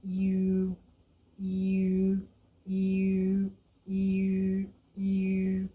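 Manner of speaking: "यू" with rounded lips, Chinese ü; dotted u sound. Shown as a demonstration. Teacher modelling the dotted u for learners, rounded ü. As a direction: repeat "यू" like Mandarin ü, lips pursed. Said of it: "लेकिन इसको पढ़ना इस तरह".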